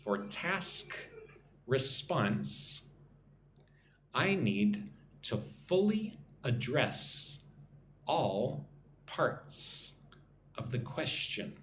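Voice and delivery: slow repeat-after-me phrasing with clear S sounds; nice and slow. Shown as a demonstration. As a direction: pause between chunks so learners can repeat. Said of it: "nice and slow".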